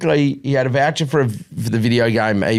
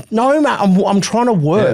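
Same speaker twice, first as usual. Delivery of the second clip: higher, pleading exasperation; exasperated dad; 9 semitones higher than usual. Retold or imitated. Dad working from home fends off bored son, exasperated. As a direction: push back at the kid, voice up high and strained. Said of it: "And I'm like".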